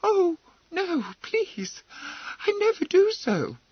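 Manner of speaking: very high, panicky pleading; frightened cry. Acted. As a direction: beg in alarm. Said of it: "cried Emily".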